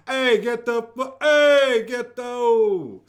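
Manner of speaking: loud, high Jersey wiseguy yelling; New Jersey accent. Retold or imitated. Host imitating a New Jersey friend, loud Jersey accent. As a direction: shout like a Jersey guy in traffic. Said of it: "Am I doing this correct?".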